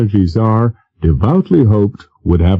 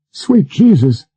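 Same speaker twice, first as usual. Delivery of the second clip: shocked oath; 8 semitones higher than usual. Acted. Driver's brakes fail.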